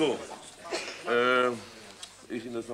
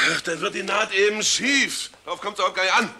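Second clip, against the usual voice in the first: higher, louder, brushing it off; impatient; 7 semitones higher, 5 dB louder than usual. Acted. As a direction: dismiss her impatiently. Irritated director dismisses objection.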